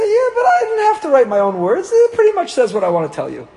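high, loud whiny excuse; mock defensive husband. Husband excusing the blank card to his spouse, high and whiny. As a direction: plead your case in a squeaky high voice.

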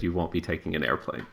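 soft, low, mysterious line; ominous quote. Retold or imitated. Host quotes Cagliostro's cryptic reply, hushed and low. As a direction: hush it like a sly magician. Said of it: "And he said".

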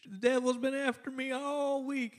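high, soft mock Southern lady sighing about the devil; Southern church lady, high and soft; southern drawl. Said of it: "one of those southern, little bit of southern drawl".

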